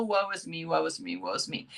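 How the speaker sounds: fading, hammed-up woe; mock moaning that sinks low and soft; mock self-pity. To viewers, mocking her old victim story, sinking mock woe. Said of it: "I had the sad sack".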